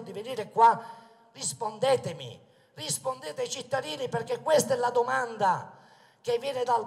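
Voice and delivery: loud rally oratory, projected to the crowd; booming balcony speech. Acted. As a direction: proclaim it from the balcony.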